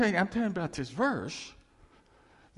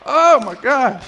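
loud exclamation; 7 semitones higher, 16 dB louder, slower than usual. Real, not acted. Exclaiming after punchline.

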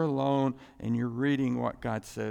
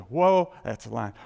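loud whoa, then dropping lower; startled awe; 4 semitones lower, 8 dB louder than usual. Acted. Preacher imagining a lion on the loose, startled awe. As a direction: recoil from a loose lion, acted. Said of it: "Whereas a lion, loose".

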